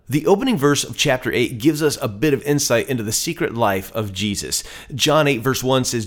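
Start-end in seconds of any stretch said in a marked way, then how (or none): none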